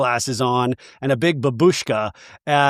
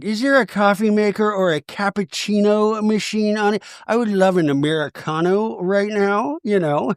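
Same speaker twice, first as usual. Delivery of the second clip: posh diva; 6 semitones higher than usual. Retold or imitated. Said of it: "Meredith is like".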